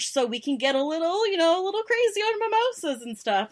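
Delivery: usual speaking voice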